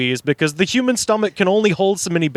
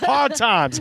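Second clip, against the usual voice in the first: higher, put-on comic lament voice; mock lament; 6 semitones higher than usual. Joking to co-host about axe murders, mock lament. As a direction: sigh out the hard times in a silly high voice.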